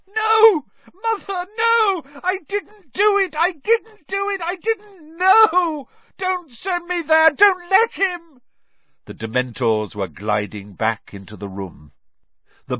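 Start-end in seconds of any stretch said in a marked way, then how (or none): 0.1-8.4 hysterical, very high pleading; screaming. A narrator's reading. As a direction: scream in terror for your mother. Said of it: "The boy began to scream".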